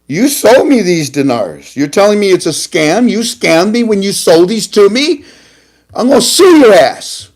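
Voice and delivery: starts firm, ends in a loud high threat; building angry outburst. Retold or imitated. Customer threatening to sue the bank teller, building to a loud yell. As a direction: rise from indignation to a yell.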